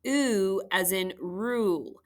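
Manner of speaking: slow, drawn-out "oo" as in rule; oo sound. Shown as a demonstration. Teacher shows families the second U sound, oo sound. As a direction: stretch "oo" like the vowel in rule. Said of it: "review the two U sounds".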